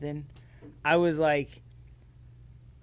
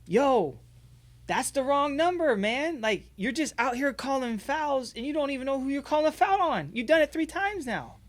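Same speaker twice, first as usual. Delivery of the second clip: frustrated yelling at ref; 10 semitones higher than usual. Retold or imitated. Dad shouting at the ref over the wrong number, heated. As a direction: call the ref out, exasperated. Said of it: "I'm like".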